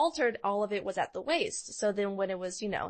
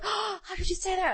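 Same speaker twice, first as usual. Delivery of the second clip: high, gasping mock outrage; shocked customer; 11 semitones higher than usual. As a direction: play the offended bride, pitch shooting up. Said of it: "the people would be like".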